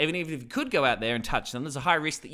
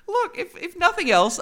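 laughing, high, slow start; 7 dB louder than usual. Real, not acted. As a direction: laugh through the words. Laughs at own joke.

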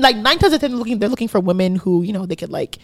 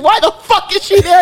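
higher, slightly louder mock outrage; mock outraged friend; 9 semitones higher, 5 dB louder than usual. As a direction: toss it off as the jealous friend, pitch jumping. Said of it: "he was pissed".

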